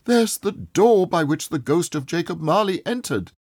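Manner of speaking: almost shout it with glee; breathless old man nearly shouting. A narrator's reading. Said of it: "he all but shouted".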